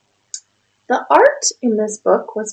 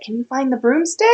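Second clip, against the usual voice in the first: ordinary delivery, nothing marked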